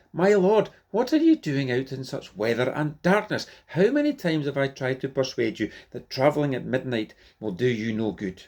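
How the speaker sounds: lightly acted remonstrance to a king; remonstrating burgess. A narrator's reading. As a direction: start high and insistent, easing as you go. Burgess admonishing king in story.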